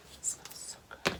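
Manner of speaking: breathy whisper of delight. Real, not acted. Vlogger smells the scrub, whispering in delight. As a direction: whisper it like a treat. Sniffing the bath balm.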